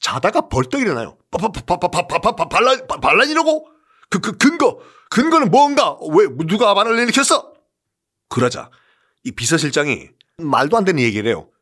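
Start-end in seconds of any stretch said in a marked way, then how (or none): 1.3-7.5 점점 높아지며 말을 더듬기; 혼비백산해 다그치며 묻기; 겁먹은 더듬기. Acted. 황제가 겁에 질려 더듬음. (반란 소식에 질린 황제, 높이 더듬으며 다그침)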